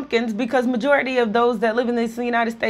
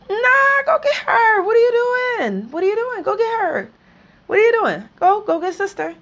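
outraged demand; 10 semitones higher than usual. Real, not acted. Yelling at government to rescue Griner.

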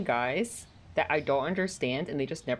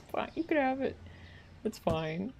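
giving in to the cat; 8 semitones higher, 4 dB quieter than usual. Real, not acted.

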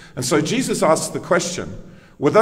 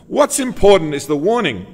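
ordinary delivery

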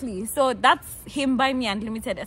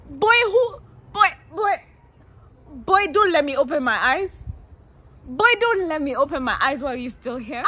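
much higher, loud outraged shriek; indignant yell; 6 semitones higher, 8 dB louder than usual. Retold or imitated. Little girl yelling at the boy at her door, loud and high. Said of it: "I said".

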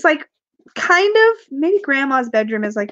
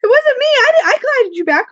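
high, squeaky protest of innocence; retold high-pitched denial to a friend; mock innocent denial; 12 semitones higher, 5 dB louder than usual. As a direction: play the falsely accused, pitched way up. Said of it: "Oh, I was like".

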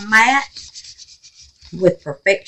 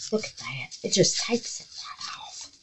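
a soft amazed aside; marveling whisper; 13 dB quieter than usual. Real, not acted. Showing viewers the paint lifting, whispering. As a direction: hush it, impressed.

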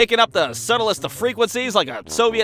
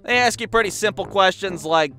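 ordinary talk, nothing marked

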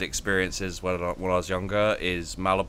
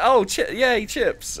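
put-on girl excited over chips; 12 semitones higher, 4 dB louder, slower than usual. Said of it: "just like, just like".

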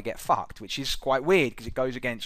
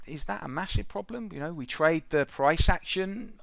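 imagined doubter; 7 semitones higher, 4 dB quieter than usual. Retold or imitated. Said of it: "you might be sitting there thinking".

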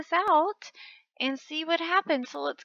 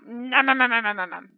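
eating noises; 4 semitones lower, 3 dB louder than usual. Pretend.

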